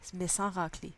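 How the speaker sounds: ordinary talk, nothing marked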